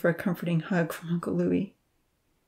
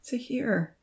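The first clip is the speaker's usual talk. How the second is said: soft pleading call of his name; 5 semitones higher, 6 dB quieter than usual. A narrator's reading. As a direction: plead with him quietly. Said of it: "she pleaded".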